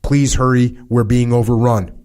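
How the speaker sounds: ordinary delivery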